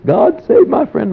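high, loud plea on his knees; pleading prayer. Retold or imitated. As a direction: beg on your knees. Lenny praying on his knees.

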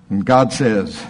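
usual speaking voice

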